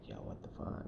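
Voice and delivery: retold hushed aside to a friend; very soft, voiceless mock-secret whisper; furtive whisper. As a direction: whisper it under your breath so the stranger won't hear. Friends secretly conferring, wary of stranger.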